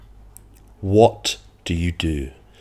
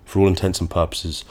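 ordinary talk

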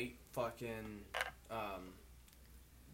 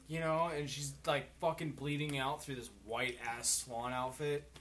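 normal talking voice

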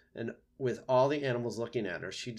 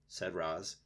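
ordinary delivery

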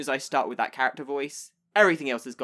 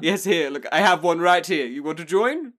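louder, lightly acted eager host offering a drink; pushy drinking host; 3 semitones higher, 8 dB louder than usual. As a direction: press them playfully to join in. Acting drinker pressing others to join.

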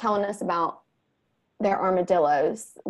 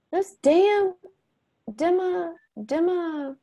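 put-on much higher, the boy stumbling over the word; mother imitating her son, higher and halting; fumbling kid voice; 11 semitones higher, much slower than usual. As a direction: play a child who can't finish the word.

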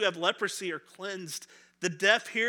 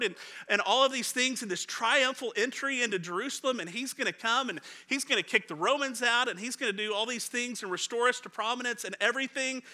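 ordinary talk, nothing marked

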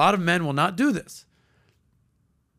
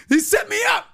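very high, louder mock whine; duped guy; 4 dB louder than usual. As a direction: wail that you got played. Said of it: "He's like".

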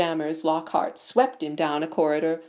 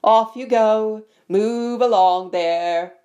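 loud, slowing call to a crowd; 4 semitones higher, 7 dB louder, slower than usual. A narrator's reading. Teacher disperses the pupils, calling out. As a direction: send them off in a carrying voice. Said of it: "Lockhart called to the crowd".

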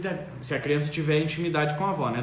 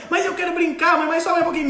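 put-on much higher, louder pleading kid voice; whiny child; 11 semitones higher, 6 dB louder than usual. Child begging mother for more play, whiny. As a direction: beg like a stubborn kid.

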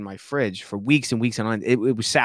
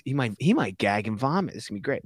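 normal talking voice

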